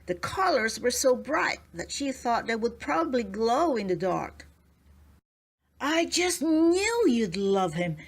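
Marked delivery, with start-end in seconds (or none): 5.8-8.0 slow, higher, beaming husband; happy. A narrator's reading. Husband proudly presents his gift to his wife, beaming and slow. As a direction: savour it, pleased with himself. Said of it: "said Mister Jones happily".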